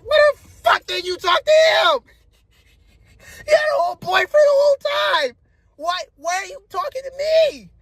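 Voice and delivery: very high, loud, incredulous rant; retold outraged yelling. Man confronting her over the hidden boyfriend, outraged yelling. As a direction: confront them in squealing disbelief.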